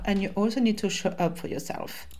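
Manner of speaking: usual speaking voice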